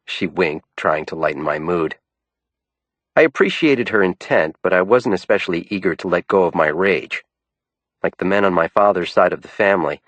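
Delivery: plain reading voice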